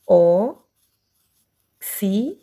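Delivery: OXÍTONA in long, separate syllables. Shown as a demonstration. Teacher dictating to pupils who write, syllable by syllable. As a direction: say OXÍTONA very slowly, pausing between syllables.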